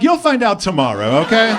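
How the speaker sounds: acted testy comeback; irritated snap. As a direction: fire back, still heated from the phone fight. Snaps back at wife mid-argument.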